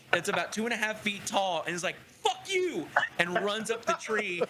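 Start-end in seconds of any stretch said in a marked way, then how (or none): 2.2-2.9 high-pitched angry little critter; pissed-off squirrel. Acted. Game master as squirrel fleeing up a tree, pissed off. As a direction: squeal it out in a huff. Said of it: "like, a pissed off squirrel".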